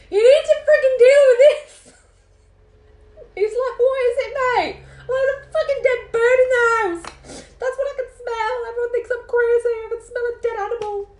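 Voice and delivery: high, loud, half-laughing panic; shouting at Tony about the dead bird, frantic and laughing; frantic yell. Retold or imitated. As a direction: yell it at him, losing the plot. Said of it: "I lost it".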